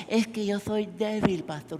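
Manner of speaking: put-on whimpering excuse; excuse-making congregant. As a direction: imitate someone pleading weakness to the pastor. Mimics congregant making excuses to pastor.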